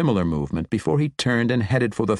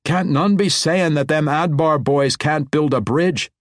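higher, louder dwarf brogue; proud dwarf boast; 8 semitones higher, 4 dB louder than usual. A narrator's reading. Dwarf king boasting to the foreman, hearty dwarf voice. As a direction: boast about the boys' work.

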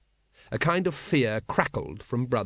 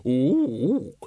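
ape hoot; 3 dB louder than usual. Acted. The librarian ape answers.

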